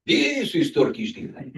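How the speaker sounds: lightly imitated Turkish accent, drawing out the correction; mock Turkish-accented insistence; Turkish vendor. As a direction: correct the customer as a Turkish market seller. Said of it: "He says".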